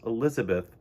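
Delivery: ordinary delivery, nothing marked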